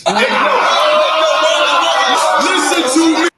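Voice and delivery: laughing shout for attention; excited laughing insistence. Real, not acted. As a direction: push through the laughter to be heard.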